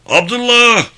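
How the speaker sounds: loud high shout of a name; calling out. Acted. The captain shouts for Abdullah, calling him loudly. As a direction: yell for him across the position. Captain calls out for his man.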